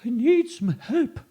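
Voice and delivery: high, loud, slow ghostly plea; ghost asking for help. Acted. Ghost asking for help, ghostly plea. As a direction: plead like a ghost. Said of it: "said the ghost".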